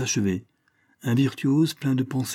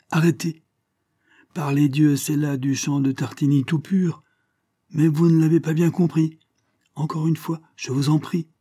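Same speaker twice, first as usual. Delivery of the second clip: higher-pitched, mildly impassioned exclamation; exclaiming; 6 semitones higher than usual. A narrator's reading. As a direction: interrupt the players with urgent enthusiasm, lightly acted. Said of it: "s'écria-t-il tout à coup". (exclamation exaltée, voix plus aiguë)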